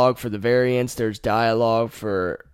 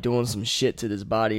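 ordinary delivery

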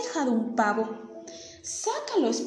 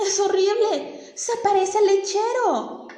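high, much louder panicked cry, pleading; horrified scream; 9 semitones higher, 7 dB louder, slower than usual. Acted. Husband opens the trunk and screams in horror at what he sees. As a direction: shriek as if you saw a monster in the trunk. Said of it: "el señor grita".

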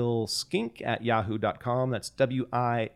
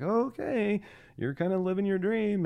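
mock high partner voice; 9 semitones higher than usual. Partner's reply imitated.

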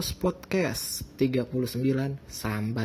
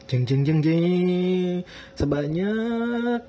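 drumroll jingle; 4 semitones higher, 3 dB louder, much slower than usual. Pretend. Drumroll before revealing a number.

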